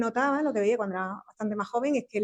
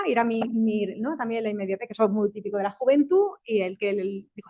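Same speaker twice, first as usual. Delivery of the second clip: ordinary talk, nothing marked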